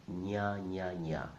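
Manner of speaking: ña repeated, ny plus a, like canyon; ña sound. Shown as a demonstration. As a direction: drill the syllable ña. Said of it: "makes that sound".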